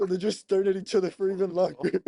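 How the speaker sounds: cracking up mid-story; laughing. Real, not acted. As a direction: tell it through real laughter. Laughing while retelling awkward prank.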